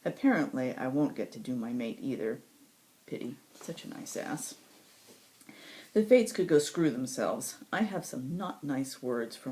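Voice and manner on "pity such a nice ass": whispered aside. Acted. Regretful aside about the mate.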